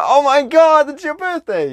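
very high, hammed-up amazement; delighted surprise. Man reacting to her birthday morning, over-the-top surprise. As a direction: burst out in mock astonishment, pitch soaring.

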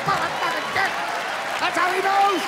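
high, loud outraged shout; furious realization yell. Retold or imitated. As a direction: explode at the discovery. Realizing dad's trick, furious.

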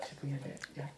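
hushed whisper to a friend. Real, not acted. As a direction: whisper the question softly. Quiet question to partner.

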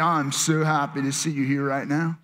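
put-on low, droning, unenthusiastic kid; reluctant kid. Kid forced to greet someone in church, reluctant drone. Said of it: "you're one of those kids".

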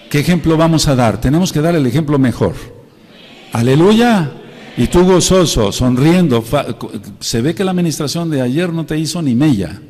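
rally the congregation; full-force preaching. Real, not acted. Pastor challenging the congregation to set an example, loud preaching.

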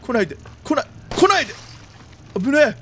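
usual speaking voice

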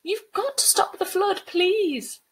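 high, loud, angry pleading; Sabrina. Acted. Girl begging the spirit to stop the flood, high angry plea. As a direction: beg her furiously to stop. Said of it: "her face flooding with angry heat".

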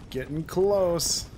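slow, teasing call, "close" held long; drawn-out mock yell. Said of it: "That's what I yell".

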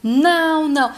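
understated outcry of refusal; mild cry. A narrator's reading. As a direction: cry out no in restrained narration. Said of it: "dá um grito e diz".

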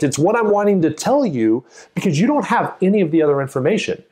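ordinary talk, nothing marked

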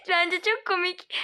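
high voice through giggles; amused, laughing. Acted. Friend laughs at the scolding. (gülerek, tiz sesle)

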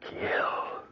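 tortured whisper. Acted. Charles echoing the voices' command, tortured whisper. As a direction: breathe the word like a compulsion.

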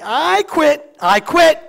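loud, high, fed-up "I quit"; mock exasperated shout; exasperated giving up. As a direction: throw up your hands and shout it. Pastor acts out giving up.

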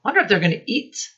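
usual speaking voice

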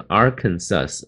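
"Arkansas" as AR-kan-sus, final s pronounced; wrong ending demo. Shown as a demonstration. Teacher quoting a common mistake, "Arkansas" ending in sus. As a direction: close "Arkansas" on a sibilant sus. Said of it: "那是错的".

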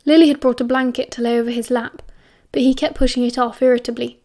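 ordinary delivery, nothing marked

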